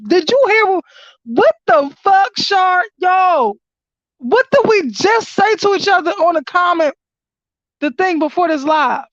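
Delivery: can't believe it, yell to your friend; excited shouting. Real, not acted. Reader calls out to Shar about their earlier talk, shouting excitedly.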